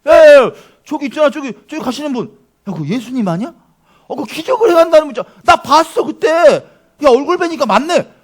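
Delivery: excited, high, loud crowd member; loud, high-pitched excited calling; someone shouting out. Acted. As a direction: call out as if spotting someone famous. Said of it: "누가 소리친 거예요".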